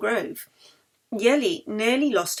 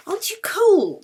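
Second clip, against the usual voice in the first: high, anxious question; worried; 12 semitones higher, 5 dB louder than usual. Acted. Yeli worries Anna is cold on the top deck, high and anxious. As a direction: fret over her friend. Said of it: "Yeli worried".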